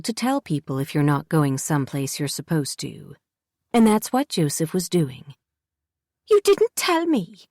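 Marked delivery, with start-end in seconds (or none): 6.3-7.4 put-on high, indignant child's voice; a hurt kid protesting; pitched-up girl, defensive; sulky girl Jessie. A narrator's reading.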